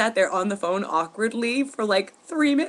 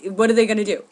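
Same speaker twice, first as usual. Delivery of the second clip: louder; 3 semitones higher, 7 dB louder, faster than usual. Retold or imitated. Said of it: "I definitely got louder".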